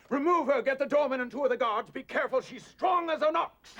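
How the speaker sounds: panic-barking at guards; rattled orders. Acted.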